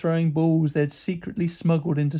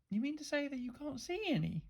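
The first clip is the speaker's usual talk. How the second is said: soft, high, falsely innocent question; sweet, quiet teasing disbelief; mock innocent; 8 semitones higher, 12 dB quieter than usual. A narrator's reading. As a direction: feign wide-eyed innocence, very quietly. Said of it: "she asked mock innocently".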